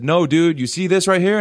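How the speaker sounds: emphatic retold brush-off; firm refusal. Acts out refusing the man.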